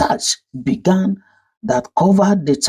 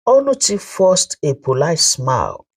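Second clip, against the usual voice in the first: plain reading voice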